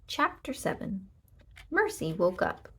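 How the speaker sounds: ordinary delivery, nothing marked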